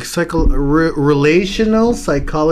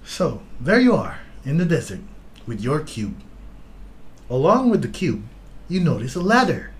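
guided meditation voice; 5 dB quieter than usual. Acted. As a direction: paint the scene calmly, with pauses. Reads the test like a guided meditation.

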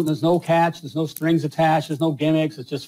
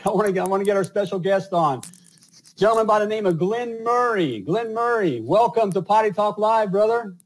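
higher, show-host announcement of the guest; announcer intro; 7 semitones higher than usual. Acted.

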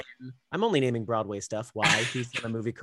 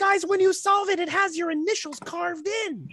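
much higher, excited announcement; gleeful discovery; 3 dB louder than usual. Retold or imitated. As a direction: squeal out the discovery. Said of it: "I was like".

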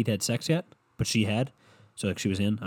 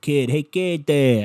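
loud, slow, higher Boston caricature; mock Boston accent; 4 semitones higher, 7 dB louder, slower than usual. Girl calling to the guy, mock Boston accent. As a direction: stretch it out in hammed-up Boston. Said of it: "why she's from Boston now".

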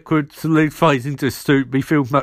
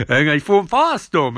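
normal talking voice

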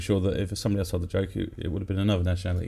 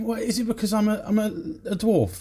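much higher, offended rant as the dwarf; indignant dwarf outburst; 11 semitones higher than usual. Retold or imitated. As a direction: go off on one, high and huffy. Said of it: "this dwarf goes off on one".

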